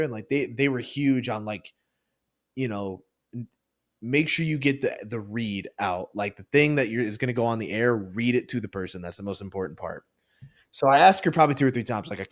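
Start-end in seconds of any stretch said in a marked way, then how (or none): none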